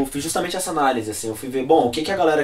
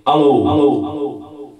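echo effect; 8 dB louder than usual. Shown as a demonstration. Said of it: "um eco bem, bem característico".

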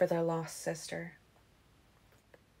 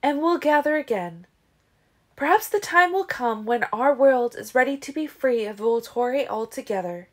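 rousing Siobhan; 5 semitones higher, 6 dB louder than usual. Acted. Siobhan voiced, high loud.

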